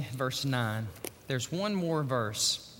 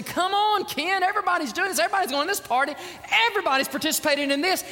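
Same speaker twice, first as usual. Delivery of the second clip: high, loud, fast-rising teasing call; egging a friend on to party; pushy football buddies; 6 dB louder, faster than usual. Retold or imitated. Football buddies pressing Ken to join the party, mock urging. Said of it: "my football buddies would constantly say".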